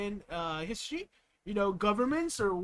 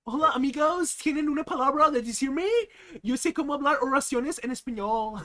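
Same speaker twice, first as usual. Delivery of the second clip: hammed-up American-Spanish accent, higher and louder; over-the-top Spanish accent; mock Spanish accent; 5 semitones higher, 4 dB louder than usual. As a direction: play a gringo showing off his Spanish. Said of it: "I'm speaking Spanish accent".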